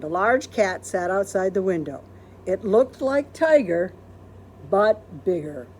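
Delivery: usual speaking voice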